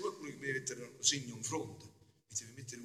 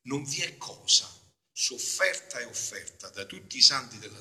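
reading aloud; 8 dB louder than usual. Acted. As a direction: read it like scripture, weighty. Reads Luisa's text aloud. (lettura solenne)

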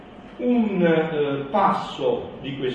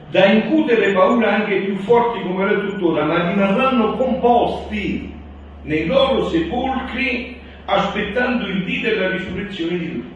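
raised, louder solemn proclamation; preaching at full force; 6 semitones higher, 7 dB louder than usual. Acted. Loud solemn reading.

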